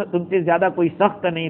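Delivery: ordinary talk, nothing marked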